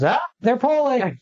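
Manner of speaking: high, slow mock announcement; announcing it. Coworker announcing a Polish surname to the office, high and slow. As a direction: proclaim it to the whole office. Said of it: "she will announce to the entire office".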